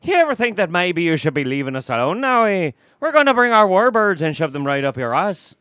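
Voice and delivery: a Romulan general hailing Picard in brogue; mock Irish Romulan. Continuing the parody.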